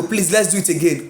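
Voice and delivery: ordinary talk, nothing marked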